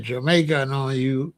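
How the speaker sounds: ordinary delivery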